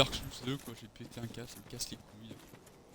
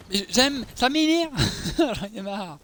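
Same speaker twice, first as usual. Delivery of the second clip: much higher, louder fed-up outburst; raging gamer; 7 semitones higher, 7 dB louder, slower than usual. Real, not acted. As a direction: explode in exasperation. Annoyed by the heavy character.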